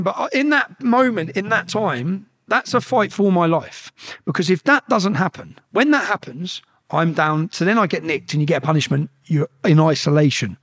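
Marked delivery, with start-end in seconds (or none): none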